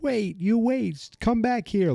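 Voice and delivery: high, drawn-out mock guard's call. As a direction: imitate a guard calling after runaways, pitched right up. Guard calling after fleeing girls.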